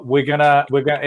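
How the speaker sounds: ordinary talk, nothing marked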